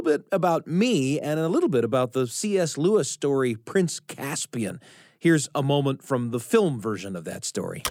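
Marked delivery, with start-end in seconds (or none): none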